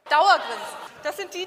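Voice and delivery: laut, mit breitem Dauergrinsen und Lachen gesprochen; grinsend vorgeführt. Shown as a demonstration. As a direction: das Schleimergrinsen laut vorspielen. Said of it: "Wie kann ich körpersprachlich schleimen?". (laut und grinsend, mit Lachen)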